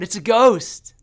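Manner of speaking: loud, high panicked shout; frightened cry. Retold or imitated. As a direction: cry out as if you've seen a ghost. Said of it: "the disciples cry out".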